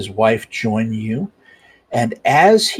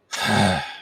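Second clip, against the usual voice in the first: a long tired sigh out through the nose; weary sigh; 4 semitones lower, 3 dB quieter than usual. Acted. Kudash sighs at the cat before scolding it. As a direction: sigh like a patient host worn thin. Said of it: "He looks down and he says".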